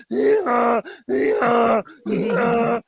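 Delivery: donkey bray. Retold or imitated. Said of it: "They're like".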